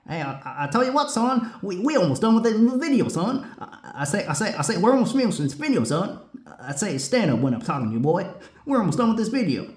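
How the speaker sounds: high, fast blustering cartoon rooster, I say, I say; blustery rooster voice. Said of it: "impression of the Looney Tunes characters".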